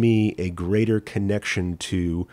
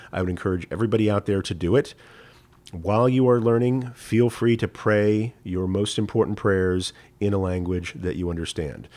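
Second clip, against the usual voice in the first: ordinary talk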